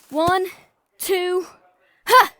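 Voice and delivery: loud count ending in a cry; jump countdown. Acted. Adventurer counting partner into a cliff jump, loud cry. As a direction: count down then leap with a yell.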